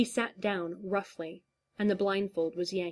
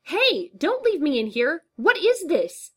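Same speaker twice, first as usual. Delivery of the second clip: loud high desperate yell; panicked shout; 9 semitones higher, 9 dB louder than usual. Acted. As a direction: shout after them, frantic. Trapped, shouting after captors.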